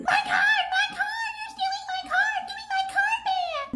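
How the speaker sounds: shrill hysterical shriek, way up high, laughing through it. Acted. Customer shrieking at the cashier over her card. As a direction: scream like your card is being stolen.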